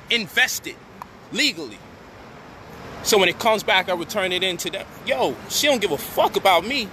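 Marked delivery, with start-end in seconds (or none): none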